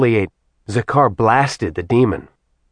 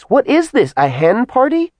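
much higher, impatient eye-rolling voice; exasperated; 12 semitones higher, 4 dB louder than usual. Acted. Kat mocking the men's chatter, exasperated and high. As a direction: get on with it, fed up. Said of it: "Kat rolled her eyes".

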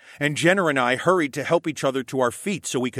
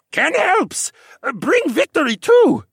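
high, loud, excitable creature voice; goofy talking animal, pitched way up and loud; Jar Jar Binks and Doug from Up blend; 9 dB louder than usual. A narrator's reading. Creature's voice popping into Alex's head, goofy and high. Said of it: "A voice that sounded like a combination of Jar Jar Binks and Doug from Up".

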